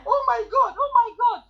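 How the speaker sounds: high, loud thrilled squeal of disbelief; excited squeal. Retold or imitated. Retelling seeing her earnings, thrilled high squeal. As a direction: shriek it like you can't believe your eyes. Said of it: "I felt like screaming".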